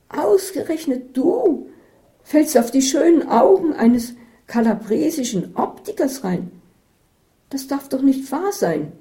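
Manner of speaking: shocked friend scolding, loud and high; outraged disbelief. Acted.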